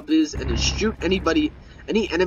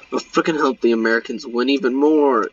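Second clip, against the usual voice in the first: usual speaking voice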